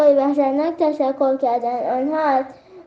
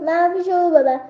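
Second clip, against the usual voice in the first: ordinary delivery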